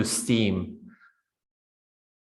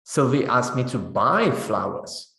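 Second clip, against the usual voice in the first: buy carries the peak, then falls; stress on buy. Shown as a demonstration. Teacher answering what to do, stressing buy. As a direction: punch buy. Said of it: "What did Sylvie ask you to do?".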